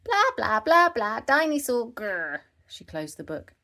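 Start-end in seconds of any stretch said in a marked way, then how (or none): 0.0-2.4 very high, loud babbling; high piglet voice pretending to read, loud; pretend reading toddler. A narrator's reading. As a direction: babble like a child playing reader. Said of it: "pretended to read it".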